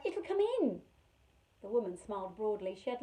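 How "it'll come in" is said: frightened cry. Acted. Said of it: "Carrie cried".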